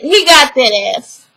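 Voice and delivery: normal talking voice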